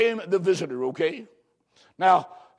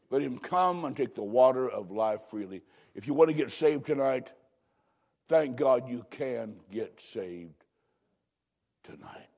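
soft invitation; 8 semitones lower, 6 dB quieter than usual. Real, not acted. Preacher inviting listeners to be saved, soft and tender. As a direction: draw them in gently.

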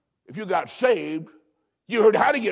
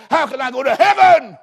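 high, loud desperate plea; begging cry; 6 semitones higher, 9 dB louder than usual. Acted. Lost man begging to find heaven, loud cry.

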